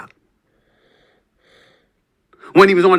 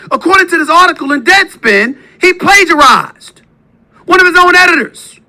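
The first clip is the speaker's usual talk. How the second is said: loud, much higher, outraged; indignant shouting; 11 semitones higher, 4 dB louder than usual. Real, not acted. Host blasting his rival's scandals, outraged shouting. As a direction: hammer each charge louder. Furious list of accusations.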